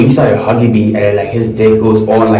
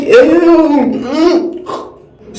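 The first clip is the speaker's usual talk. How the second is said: high, disgusted "ew"; grossed-out squeal. Retold or imitated. Disgusted reaction quoted again.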